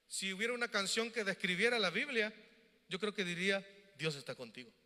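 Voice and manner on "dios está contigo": soft and low, like a gentle song line; tender. Acted. Summing up the Bible as a song, softly and tenderly. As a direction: breathe it softly, almost sung.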